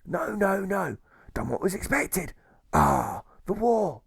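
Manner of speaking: hammed-up old sea dog grumbling; salty old pirate, scornful; gruff old pirate jailer.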